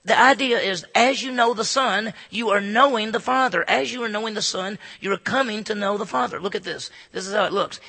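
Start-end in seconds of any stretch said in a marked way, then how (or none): none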